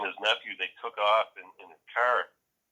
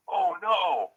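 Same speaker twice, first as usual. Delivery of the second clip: retold alarm; 11 semitones higher, 4 dB louder than usual. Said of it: "Babbles is like".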